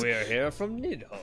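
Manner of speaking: playful medieval voice; mock old English proclamation; mock olde english. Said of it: "say it like a medieval person".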